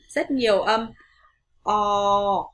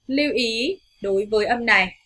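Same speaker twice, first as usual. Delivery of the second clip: normal talking voice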